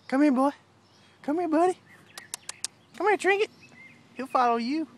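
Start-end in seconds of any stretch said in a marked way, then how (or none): 0.1-3.5 high dog call. Real, not acted. Calling the dogs over.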